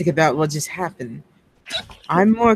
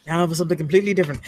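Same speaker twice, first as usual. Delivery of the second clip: rushed catchphrase; 3 semitones lower, much faster than usual. Acted. Quoting the Monty Python catchphrase.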